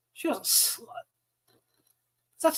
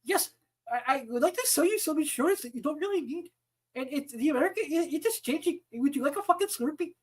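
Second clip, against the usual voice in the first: mock Indian-accented sales pitch; 3 semitones higher than usual. Telemarketer pitching needless insurance, in a mock Indian accent. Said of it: "my Indian accent offensive".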